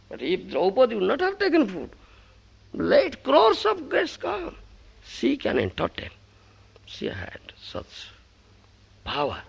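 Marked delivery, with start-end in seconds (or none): none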